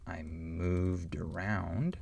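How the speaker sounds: "I moved around" slow, d joined to "around"; d linking to the vowel; slow linked d. Shown as a demonstration. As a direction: slowly link the d of "moved" into "around". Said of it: "a sentence like this".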